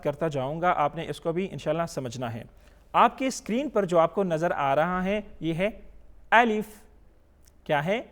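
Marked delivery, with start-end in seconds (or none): 6.3-6.6 الف said short with a clear final f; raised, louder الف, not drawn out; correct quick alif. Shown as a demonstration. Teacher showing the letter to learners, short clear الف. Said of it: "جلدی پڑھیں گے".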